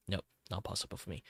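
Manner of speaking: normal talking voice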